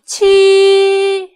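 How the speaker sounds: 七 loud, very high and flat; high-pitched first tone. Shown as a demonstration. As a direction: sustain 七 on a high unwavering note. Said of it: "First tone is high-pitched".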